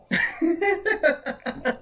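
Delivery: high giggling laugh at own joke; real laugh. Real, not acted. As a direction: crack up at your own punchline.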